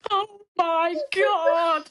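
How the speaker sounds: long drawn-out, high exclamation; loud, overwhelmed fangirl reaction; mock starstruck gasp. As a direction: stretch every word in giddy shock. Acting out their starstruck reaction.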